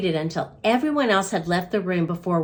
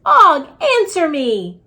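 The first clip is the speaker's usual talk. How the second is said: urgent call; 6 semitones higher, 6 dB louder than usual. A narrator's reading. Pleading with silent frog.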